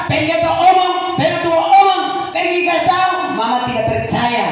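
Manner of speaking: rally the couples to church; preaching at full force. Acted. Pastor urging husbands and wives, shouted exhortation.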